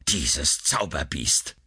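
low, slow grumble; muttering king. A narrator's reading. King Schahriar cursing the sorceress, a low mutter. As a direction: mutter the insult slowly, low in pitch. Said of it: "murmelte König Schahriar".

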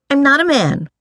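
put-on high-pitched defiant girl; girlish defiance. A narrator's reading. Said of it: "Sarai said defiantly".

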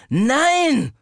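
high loud angry Nein, growled. A narrator's reading. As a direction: snarl Nein again in fury. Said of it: "wiederholte ich wütend, knurrend".

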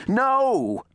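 high, loud, drawn-out cry; yell. A narrator's reading. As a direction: yell to stop the gunman. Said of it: "turned to the Bullet and yelled".

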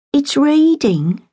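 slow, high coaxing plea; wheedling. A narrator's reading. Girl coaxing her mother for money, wheedling. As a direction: draw it out sweetly to win her over. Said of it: "she wheedled".

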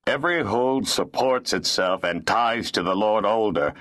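impassive, official steward; unmoved, matter-of-fact ruling. A narrator's reading. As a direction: state the rule with no sympathy. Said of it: "the steward said impassively".

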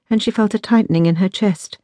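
usual speaking voice

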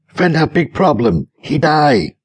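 high-pitched agitated cry in broken English; mildly screamed panicky news. A narrator's reading. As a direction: blurt it out in alarm, pitched well up. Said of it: "rushed into the room and screamed".

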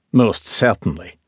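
curt and terse, a lightly voiced Highlander; clipped tone. A narrator's reading. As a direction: cut the words short, brisk and cool. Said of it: "he said in a clipped tone".

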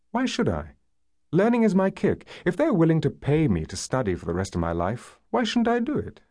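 high, bright-pitched defiant retort; spirited defence. A narrator's reading. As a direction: argue back with lively conviction. She defends her endless studying.

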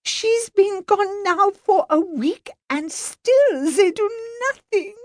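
high, slow, upset French lady; French accent, crying out indignantly. Acted. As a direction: pour out outrage in a French accent. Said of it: "she cried".

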